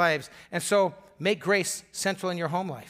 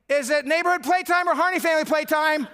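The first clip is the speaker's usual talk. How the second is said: put-on high, louder excited kid voice; be the neighborhood kids asking eagerly; 9 semitones higher, 5 dB louder than usual. Neighbor kids running over to ask the dad, high and eager. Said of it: "they'd go".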